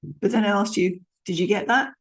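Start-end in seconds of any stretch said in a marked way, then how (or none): 1.2-1.9 rising tune on that; gentle question rise; uplift at the end. Shown as a demonstration. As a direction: lift the last word into a question. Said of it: "With an uplift at the end".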